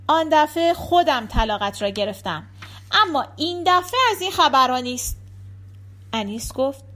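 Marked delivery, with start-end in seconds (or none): none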